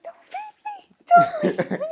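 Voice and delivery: hammed-up tiny panicked squeal; mock freaking out. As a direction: squeak out a panicked inner plea. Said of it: "but I'm, like, freaking out".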